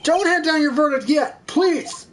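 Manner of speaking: high, urgent courtroom shout; urgent interruption. Acted. Lawyer stopping the verdict, urgent high shout. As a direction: cry out to halt everything, pleading.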